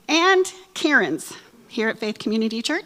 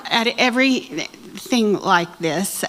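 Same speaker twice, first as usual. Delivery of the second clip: mock bawling; slower than usual. Shown as a demonstration.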